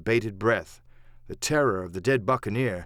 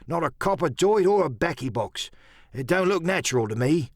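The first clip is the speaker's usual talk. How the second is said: slightly raised sailor's voice; pirate puzzled by a find, pitched up; Merry, suspicious pirate; 5 semitones higher than usual. Acted. Merry feeling among the bones, uneasy higher pirate voice.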